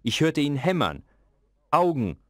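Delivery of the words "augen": sudden raised call; a sudden shout of demand. A narrator's reading. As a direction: call out the word sharply. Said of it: "rief er plötzlich".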